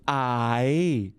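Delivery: drawn-out, lilting Colombian intonation; Colombian sing-song. Shown as a demonstration. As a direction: stretch it into a Colombian lilt. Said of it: "is very sing-songy".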